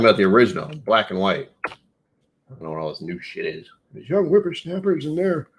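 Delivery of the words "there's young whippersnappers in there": put-on higher creaky old-timer voice; grumpy old man voice. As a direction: grumble like a cranky grandpa.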